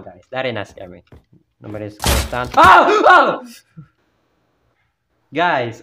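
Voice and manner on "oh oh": loud high startled yells; scared shout. Real, not acted. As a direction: cry out in real fright. Jump scare in horror game.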